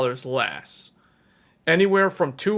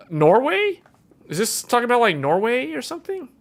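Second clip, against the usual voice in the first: much higher, louder, incredulous; baffled question; 9 semitones higher, 4 dB louder than usual. Real, not acted. Squinting at a Spanish ad, baffled and incredulous. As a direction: ask in amused disbelief.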